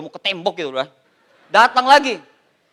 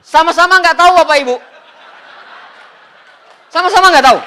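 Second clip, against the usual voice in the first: laughing punchline; 7 semitones higher, 7 dB louder, faster than usual. Real, not acted. Punchline to audience.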